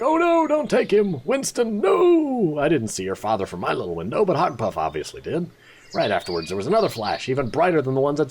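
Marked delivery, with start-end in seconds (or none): 0.0-2.6 high, slow imitated cry; mock anguished shout. Apprentice imitating Hogpuff's cry, lightly acted panic. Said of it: "shouted something like".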